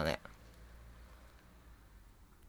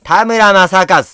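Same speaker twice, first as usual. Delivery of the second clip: slow, loud, higher hint of Tamura; Tamura Masakazu impression; 7 semitones higher, 10 dB louder, much slower than usual. Asked for Tamura, a slow loud hint of him. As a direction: draw it out like the actor.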